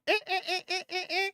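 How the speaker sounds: high squeaking sneaker noises; squeaky sneaker steps. Retold or imitated. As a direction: imitate sneakers squeaking on floor. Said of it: "She'll be like".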